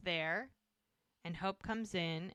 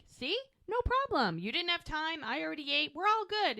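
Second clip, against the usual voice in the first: breezy Doc; 8 semitones higher than usual. Retold or imitated. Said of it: "like he's".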